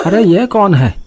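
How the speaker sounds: high, startled cry in the night; startled fear. Acted. Husband startled awake by crying. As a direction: jolt awake and ask in alarm.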